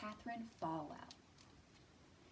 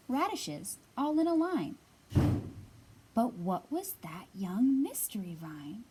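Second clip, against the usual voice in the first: louder, lively storybook read-aloud; reading to children; 8 dB louder than usual. Acted. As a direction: read it out big and bright for a circle of kids. Reads picture book aloud.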